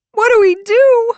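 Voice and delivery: very high, loud wail; sobbing. Acted. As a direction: wail it in helpless panic. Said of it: "gasping through her sobs".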